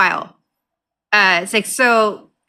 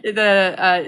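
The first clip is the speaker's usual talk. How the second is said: ordinary delivery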